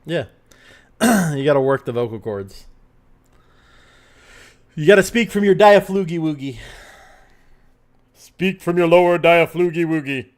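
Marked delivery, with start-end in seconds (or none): 1.4-10.3 loud, booming announcer voice. Pretend. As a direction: boom it out like a radio announcer. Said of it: "a little bit of announcer voice".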